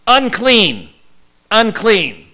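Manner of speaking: high, loud drawn-out warning call; warning yell. Retold or imitated. Lepers warning passers-by to keep distance, loud yell. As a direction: shout it to keep people away. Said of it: "they were commanded to yell".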